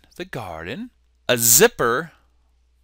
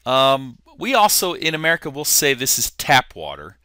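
normal talking voice

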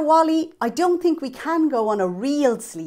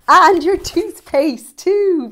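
excited reveal; 7 semitones higher, 10 dB louder, slower than usual. Acted. Children's presenter announcing.

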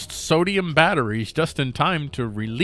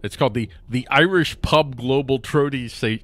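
ordinary talk, nothing marked

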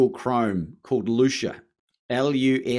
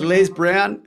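loud, high, breathless with excitement; excited greeting; 8 semitones higher, 7 dB louder than usual. Retold or imitated. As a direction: hail him like a long-lost hero. Excited star-struck greeting on phone.

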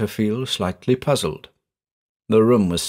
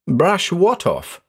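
baffled question; 7 semitones higher, slower than usual. A narrator's reading. Baffled friend answers horrified man.

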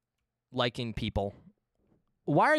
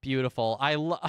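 normal talking voice

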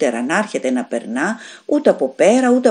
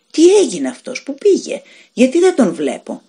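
bewildered; 7 semitones higher, 4 dB louder than usual. A narrator's reading. Ruth bewildered, stranger vanished.

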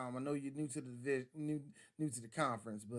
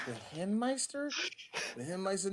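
incredulous mock; 5 semitones higher than usual. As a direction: repeat the nickname in disbelief. Mocking the nickname.

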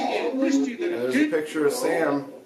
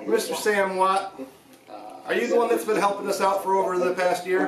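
raised voice calling out to a spirit; 7 semitones higher than usual. Real, not acted. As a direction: call out into the empty room.

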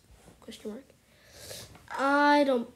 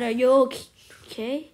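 ordinary talk, nothing marked

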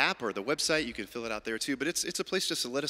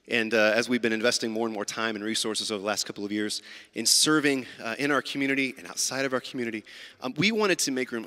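normal talking voice

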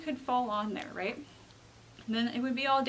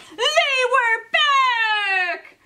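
very high, loud, drawn-out wail; terrified cry; 11 semitones higher, 11 dB louder, much slower than usual. Acted. Jasper discovering the underwear in the bathroom, terrified wail. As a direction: scream it like the worst nightmare came true. Rabbit finds them again.

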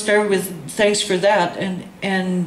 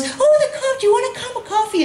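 a much higher, syrupy mock of the president offering coffee; mock sweet president; 14 semitones higher than usual. Mimicking the board president offering coffee to someone else, sugary and high. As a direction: lift the voice way up into a sugary hostess.

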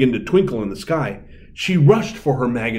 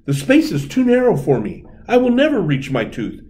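despairing fairy; 9 semitones higher, 3 dB louder than usual. A narrator's reading. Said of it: "she said".

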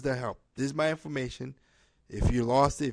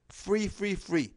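each "free" pitched up high and punched; emphatic free; 7 semitones higher, slower than usual. Real, not acted. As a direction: ring out "free" high, three times. Stressing the offer is free.

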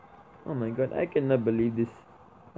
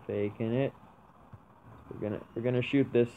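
usual speaking voice

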